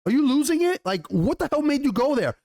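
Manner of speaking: high-pitched, incredulous mock outburst; flipping out. Son challenges mother's secret trip, imitated outburst. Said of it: "Jacaerys flips".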